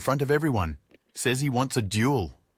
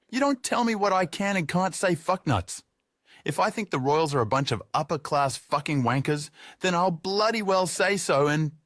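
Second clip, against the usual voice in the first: put-on higher, rowdy drunken rant; drunk tradie ranting; 8 semitones higher than usual. Drunk tradie rants at Julius about royals, rowdy high voice. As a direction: rail at him like a pub drunk.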